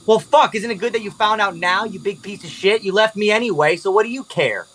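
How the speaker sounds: high-pitched, indignant lashing out; angry outburst. Acted. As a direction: throw it back at them, pitched high and hurt. Olivia lashes out in quarrel.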